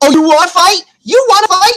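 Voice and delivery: high-pitched mock-riled questions, climbing; riled challenge. Man answers her call to fight, riled challenge.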